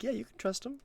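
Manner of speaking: high, breezy fake assurance; game master lying to the party, falsely reassuring; mock reassuring lie. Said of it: "be like".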